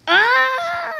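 sudden high yelp of fright; startled scream. Real, not acted. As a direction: shriek as he falls. Sudden fall in game.

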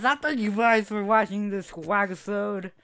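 upbeat sign-off voice; YouTube outro. Acted. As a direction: wrap it up like a host. Outro announcer read.

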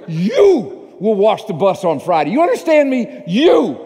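high, loud, furious order; angry scream. Retold or imitated. Mad pastor orders staffer to wash bus, angry scream. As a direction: lay down the law at full volume, drawing out the command. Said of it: "He's so mad, he screamed".

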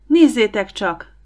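loud, slow call to the others; slow excited shout of discovery. Acted. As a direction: call out so they all look. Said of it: "kiáltotta Don".

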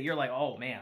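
ordinary delivery

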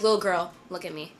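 low, soft, firm address; hushed grown-up seriousness, retold; serious woman stranger. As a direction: quietly get a child's full attention. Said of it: "she's like".

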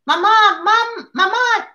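high, excited call for mom. Acted. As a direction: burst in calling mama with joy. America bursts in calling mother.

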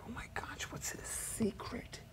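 hushed, breathless wonder; awed whisper. Retold or imitated. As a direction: whisper it like a secret. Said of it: "look at Kung Fu masters as like".

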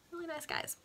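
deadpan the irony dryly; sarcastic. Real, not acted. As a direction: reader mocking the killers, sarcastic. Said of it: "sarcastic comment".

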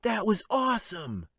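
put-on high excited boy voice; amazed boy. A narrator's reading.

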